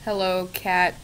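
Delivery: usual speaking voice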